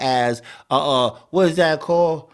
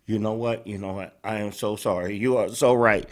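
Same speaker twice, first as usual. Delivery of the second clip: low, soft feigned apology; mock self-blame; 7 semitones lower, 6 dB quieter, faster than usual. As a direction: play a contrite woman, voice sunk low. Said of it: "putting all the blame on herself".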